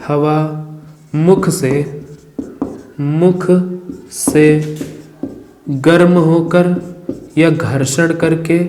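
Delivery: slow, phrase by phrase dictation for notes. Shown as a demonstration. Teacher dictating a definition to students, slow and deliberate. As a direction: repeat each chunk so students can write it down.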